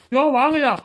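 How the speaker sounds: loud, high call across the beach. Acted. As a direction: summon the men quickly. Policeman calls others to help. (உரக்க கூப்பிடுதல்)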